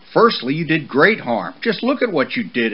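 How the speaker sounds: stern telling-off; scolding father. Acted. Father horse scolding his son over the mess, loud and stern.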